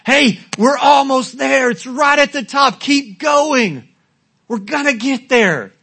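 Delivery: shouting from the hilltop. Retold or imitated. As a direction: yell back down to the tired group. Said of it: "shout to everybody".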